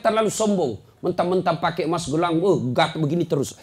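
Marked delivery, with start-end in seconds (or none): none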